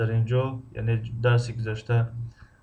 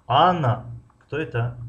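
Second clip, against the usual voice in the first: strong rise-fall on Анна; stress on the first word; 3 semitones higher than usual. Shown as a demonstration. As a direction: hit Анна hard and let кто это drop away. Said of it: "агар дар интонатсионная конструкция ду".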